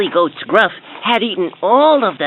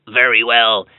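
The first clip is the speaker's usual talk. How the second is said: deep troll voice; 7 semitones lower than usual. A narrator's reading. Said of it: "said the Troll".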